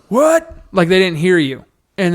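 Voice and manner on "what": high rising what; mock crowd yelling what, pitched high. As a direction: imitate the crowd's taunting what. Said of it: "the whole crowd just goes".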